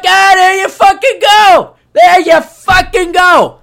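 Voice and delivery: high, loud, slow triumphant yell; stretched-out victory shout; jubilant shout. Retold or imitated. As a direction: roar it out like your guy just won. Celebrating friend's CPA by email.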